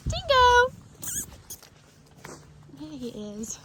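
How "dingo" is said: loud high call across a field. Real, not acted. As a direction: call the horse from afar. Calling horse across field.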